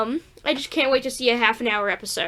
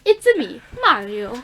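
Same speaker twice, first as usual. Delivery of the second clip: Mario voice; 4 dB louder than usual. Retold or imitated. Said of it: "I wish he sounds like that".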